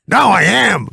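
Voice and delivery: high, emphatic mock outburst; mad Mickey Rooney. Old star not being believed, imitated angry insistence. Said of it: "and then he'd get mad".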